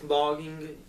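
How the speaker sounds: ordinary talk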